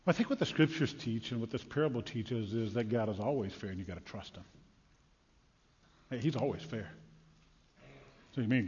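normal talking voice

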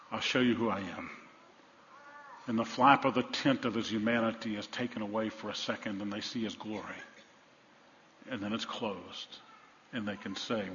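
soft, low, reverent storytelling; hushed preaching. Real, not acted. Preacher tells of Jesus revealing himself, hushed preaching. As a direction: bring the sermon down to a hush.

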